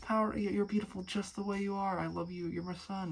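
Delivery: imagined father gently telling his son he is loved; loving dad. Retold or imitated. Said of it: "his father would be like".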